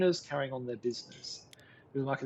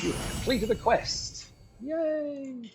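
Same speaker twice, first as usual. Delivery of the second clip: high, drawn-out announcement into a squeaky mock cheer; mock-triumphant, pitched way up; playful quest celebration; 11 semitones higher, much slower than usual. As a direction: announce it slowly and high, topping it with a little yay. Celebrating a completed game quest.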